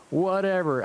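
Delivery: hammed-up teenage yell; mock yell in a Southern accent; yelled angrily. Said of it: "I yelled angrily".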